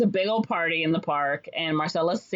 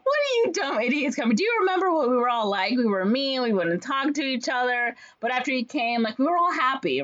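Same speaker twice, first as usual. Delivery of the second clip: high, impassioned plea to a crowd; mock scolding; 7 semitones higher than usual. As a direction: imitate telling off the whole town, pitched up. Heroine scolds the townspeople.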